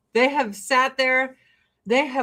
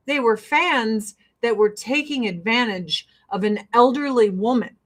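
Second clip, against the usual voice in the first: ordinary talk, nothing marked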